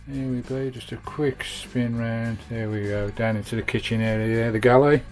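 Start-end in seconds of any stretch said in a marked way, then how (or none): none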